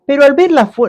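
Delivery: sudden high, loud burst; dramatic rise. Acted. Preacher telling of the winds, with a sudden high burst. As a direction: hit it with alarm.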